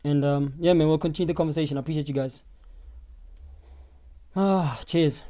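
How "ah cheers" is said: normal talking voice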